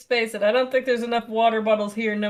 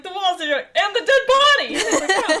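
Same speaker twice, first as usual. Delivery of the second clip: excited exclaim; 8 semitones higher than usual. Retold or imitated. Mimicking game character's reaction.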